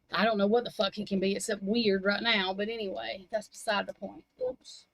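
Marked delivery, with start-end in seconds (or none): none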